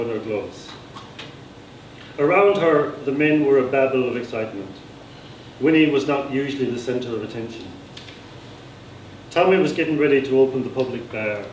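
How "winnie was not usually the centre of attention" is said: ordinary delivery, nothing marked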